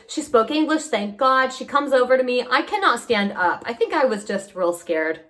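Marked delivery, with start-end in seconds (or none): none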